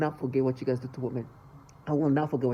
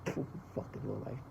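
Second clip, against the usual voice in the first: muttered curse; 6 semitones lower, 14 dB quieter than usual. Real, not acted. Muttered insult at abusers.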